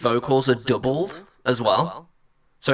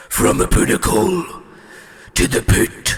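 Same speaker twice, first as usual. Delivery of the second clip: harsh throaty growl, much louder; too guttural; 3 semitones higher, 6 dB louder than usual. Shown as a demonstration. Singer testing a villain growl, too guttural. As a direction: rasp it from the gut like a demon. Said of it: "That might be a little bit too guttural".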